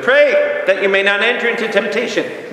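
high, ringing scriptural exhortation; urgent command. Acted. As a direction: call out the first word, then press on. Acts out Jesus's command to disciples.

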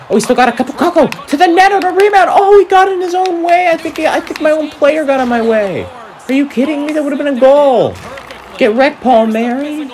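frustrated outburst. Real, not acted. Gamer yelling after his own teammate blocked his shot, frustrated disbelief. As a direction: vent at the blunder in disbelief.

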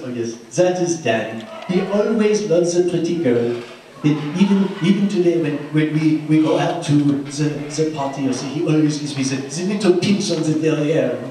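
lightly voiced French lady accent; a hint of a petite Frenchwoman's accent. Teller imitating Josie at dinner, light French accent. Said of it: "Josie leaned over and said".

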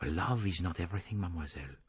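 put-on soft, accented gentle voice; gentle reproof, dropping softly away. A narrator's reading. Detective tells her love is not everything, gentle. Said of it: "Poirot said gently".

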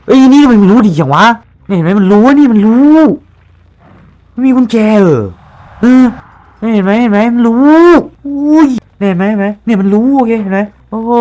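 high, loud excited yelling; panicked gamer yelling; startled outburst. Real, not acted. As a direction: shout in shocked disbelief. Streamer startled, killer knows.

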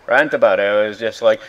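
usual speaking voice

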